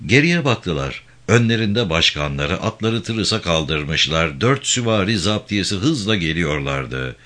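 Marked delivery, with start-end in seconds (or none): none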